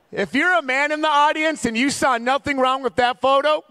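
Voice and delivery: high, loud mock-indignant call; comic rant. Preacher rallying men in the audience, comic rant. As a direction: raise it high to rally the crowd.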